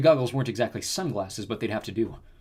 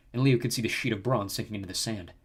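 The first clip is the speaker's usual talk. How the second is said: plain reading voice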